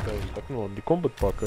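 ordinary delivery

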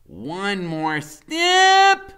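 high, very slow, loud coaxing; Freak's voice. A narrator's reading. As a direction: stretch it out like a careful guide. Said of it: "Freak says".

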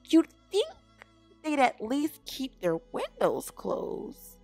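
high, loud gossipy neighbour voice; scandalised squirrel. A narrator's reading. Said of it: "said Missus Squirrel".

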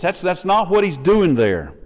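ordinary talk

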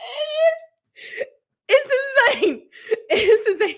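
high, loud words through laughter; laughing disbelief. Real, not acted. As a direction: gasp it out while cracking up. Laughing at the absurd book plot.

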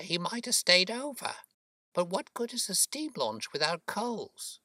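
high, chattering working woman's voice; puzzled boatman's wife. Acted. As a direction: puzzle it out aloud, pitched high. Voices Mrs Smith, puzzled.

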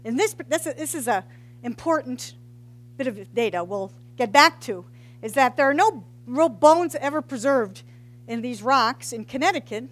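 ordinary talk, nothing marked

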